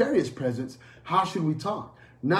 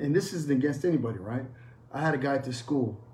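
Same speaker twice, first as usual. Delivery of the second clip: usual speaking voice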